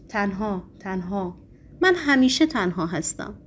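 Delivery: usual speaking voice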